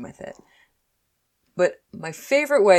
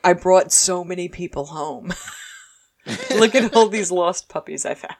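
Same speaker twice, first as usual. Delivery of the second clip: laughing proud John; 2 semitones higher than usual. Retold or imitated. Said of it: "He's like".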